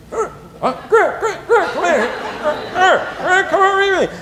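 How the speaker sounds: high, strained mock pleading; frantic calling. Dad in bed calling his son over, hammed-up frantic. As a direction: call the child back in a squeaky, urgent voice.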